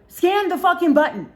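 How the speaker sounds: high, loud exasperated order; re-enacted exploding impatience; retold angry shout. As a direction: shout it at the end of your patience. Finally snaps in the elevator.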